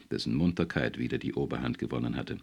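ordinary delivery

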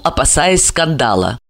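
usual speaking voice

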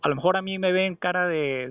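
usual speaking voice

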